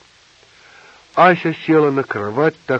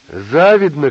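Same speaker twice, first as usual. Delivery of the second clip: higher, loud taunt; jeering shout; 4 semitones higher, 8 dB louder, slower than usual. A narrator's reading. Asya taunting her roommates, shouting. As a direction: throw the taunt out loudly. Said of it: "кричала Ася".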